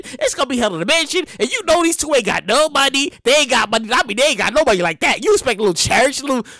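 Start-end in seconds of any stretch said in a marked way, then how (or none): none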